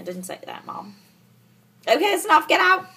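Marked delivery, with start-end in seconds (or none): 1.8-2.8 high, raised-voice order; loud dismissal. Real, not acted. Vlogger shooing a visitor off camera, raised voice. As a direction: send them away loudly.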